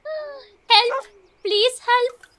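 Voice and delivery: the stuck cat yelling to the dog, mock panic; mock cry for help.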